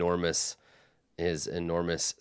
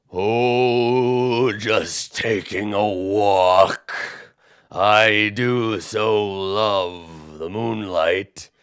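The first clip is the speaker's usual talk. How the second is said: slow, louder giant, dreamy and polite; big giant musing about the moonlight; genteel giant; 4 dB louder, much slower than usual. Acted. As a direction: linger like a giant strolling in moonlight. Giant voice continues.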